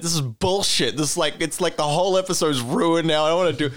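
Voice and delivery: imitating a frustrated co-host losing it; mock meltdown. Imitating the actor who had trouble getting an impression, mock frustrated. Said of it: "losing your shit about it".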